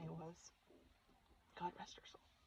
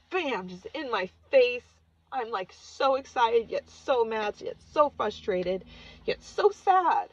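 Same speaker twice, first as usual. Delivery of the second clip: loud, higher and slow, swelling with feeling; overwhelmed; 4 semitones higher, 12 dB louder, slower than usual. Real, not acted. Daughter describing her late mother's vision, loud and overwhelmed. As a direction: pour it all out, each so bigger.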